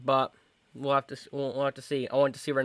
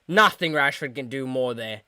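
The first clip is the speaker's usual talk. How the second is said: ordinary delivery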